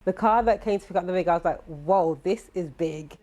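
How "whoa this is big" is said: ordinary talk, nothing marked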